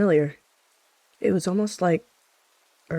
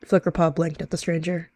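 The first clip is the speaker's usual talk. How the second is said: usual speaking voice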